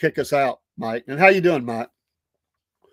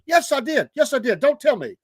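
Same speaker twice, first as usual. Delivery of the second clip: imitated drunk friend getting huffy, pitched up; mock-indignant, high-pitched insistence; getting mad; 8 semitones higher than usual. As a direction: argue back like an offended drunk. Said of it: "He started getting mad".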